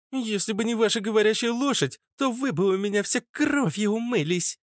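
put-on high-pitched childish menace; threatening feisty girl. Held girl threatens the group, squeaky and defiant.

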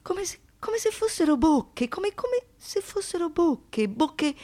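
ordinary talk

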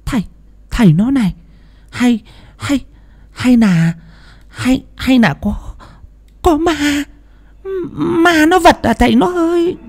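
high, halting, frightened wife voice; stammering in fear. A narrator's reading. Frightened wife stammering to husband about a ghost, high and halting. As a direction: stumble over every word, scared of ghosts. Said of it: "tiếng lắp bắp của bà Thủy".

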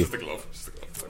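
quick hushed whisper. Real, not acted. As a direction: whisper it fast aside. Panda costume aside, whispered.

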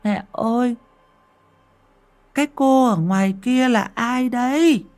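put-on high, slow little child voice; small boy asks mother about the ghost, childlike; babbling toddler. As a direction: innocent three-year-old asking his mum. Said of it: "cứ bi ba bi bô".

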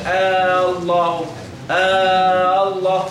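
"آه الله" with a very long held opening aa; wrong added alif. Shown as a demonstration. Teacher warning against adding alif, opening vowel overlong. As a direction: hold the first vowel of "آه الله" on a level note. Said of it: "ما تقولش".

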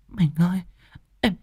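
high, faint, pained pleading; weak moaning. Acted. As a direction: beg through the pain, barely able. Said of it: "miệng rên xiết yếu ớt".

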